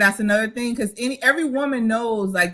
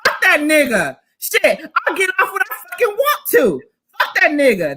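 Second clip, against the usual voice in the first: defiant mock rant; 5 semitones higher, 4 dB louder than usual. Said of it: "then you're like".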